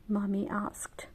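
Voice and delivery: plain reading voice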